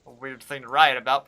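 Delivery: ordinary talk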